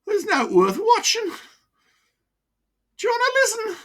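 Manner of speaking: put-on high, broad Wearside voice of an old miner; chatty old pitman with a wheezy chest. Old miner to his doctor, broad Wearside. Mickey reminisces.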